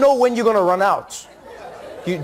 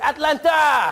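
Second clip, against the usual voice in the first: put-on high, exclaimed French-accented arrival; 6 semitones higher, 3 dB louder, slower than usual. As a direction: announce the discovery as an amazed Frenchman. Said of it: "I was like".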